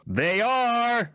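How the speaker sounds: high, drawn-out holler down from a perch; shouting from above. Acted. Dirham answering the others from above, high drawn-out shout. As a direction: call it out long and high. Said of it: "Dirham says from above".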